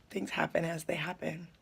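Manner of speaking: hushed aside, breathy and voiceless; whisper. Real, not acted. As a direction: drop to a secret whisper. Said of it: "whispered aside".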